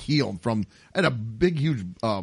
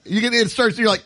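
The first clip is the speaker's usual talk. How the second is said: ordinary talk, nothing marked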